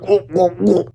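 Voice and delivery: fading cries; wailing. Acted. Principal flushed away.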